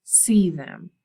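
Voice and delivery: "see" pressed loud against "look"; stress on see. A narrator's reading. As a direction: make "see" the point. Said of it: "Don't just look at them".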